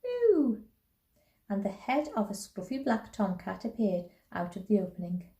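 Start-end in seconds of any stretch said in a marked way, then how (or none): 0.0-0.6 high cat yowl; screech. A narrator's reading. Tomcat popping out of the basket, high screech. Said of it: "a screech of".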